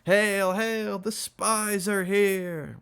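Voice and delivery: put-on very high, slow drunken wail. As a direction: wail it out in long held words. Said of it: "wailed the heavy one".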